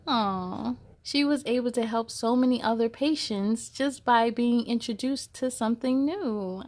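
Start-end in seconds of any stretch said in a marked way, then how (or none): none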